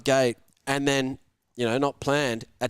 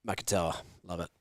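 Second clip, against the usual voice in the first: ordinary delivery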